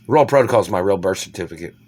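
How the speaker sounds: ordinary delivery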